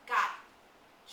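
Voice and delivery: sudden loud triumphant shout. Acted. Jack to Ralph, spotting the pigs, loud shout. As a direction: blurt it out loud enough to startle. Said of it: "Jack shouted so loudly that Ralph jumped".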